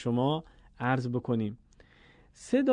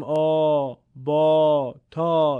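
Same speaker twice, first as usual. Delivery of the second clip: Persian rounded long "aw" on «آ، با، تا»; wrong Persian-accented «آ، با، تا»; 7 dB louder, much slower than usual. Shown as a demonstration. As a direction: say «آ، با، تا» with a dark Persian vowel. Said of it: "این لهجهٔ فارسیه".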